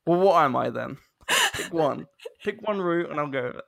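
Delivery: loud, retold protest; mock exasperation. Student retelling retort to lecturer's criticism, mock exasperated. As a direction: protest loudly, half laughing at it.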